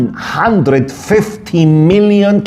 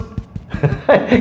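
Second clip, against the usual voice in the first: soft breathy chuckle; 3 semitones higher, 5 dB quieter than usual. Real, not acted. Laughs at guilty man.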